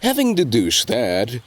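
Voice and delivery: plain reading voice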